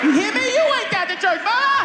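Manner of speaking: loud, high-pitched threat; escalating defiance. Retold or imitated. Son warning his mother back, shouted defiance.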